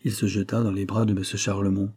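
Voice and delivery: ordinary delivery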